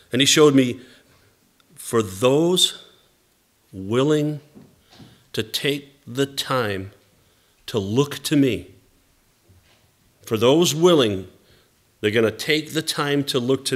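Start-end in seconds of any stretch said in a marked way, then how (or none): 1.8-8.7 halting, with long pauses between phrases; measured quotation. Retold or imitated. Pastor relaying God's answer about the fellowship, measured and spaced out. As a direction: deliver it phrase by phrase, leaving space after each.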